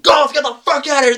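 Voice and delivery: high, fast imitated yell; rushed, high-pitched shouted order; guard's shout. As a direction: yell like security clearing a crowd. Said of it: "security guards ... start whacking kids".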